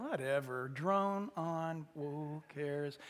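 low, soft, dismissive groan; bored listener. Acted. Bored people tune out the talker, low dismissive drone. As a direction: sink into drawn-out indifference. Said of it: "people are like".

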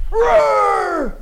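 loud high shouted roar; lion roar. Acted. Preacher imitates a lion's frontal attack.

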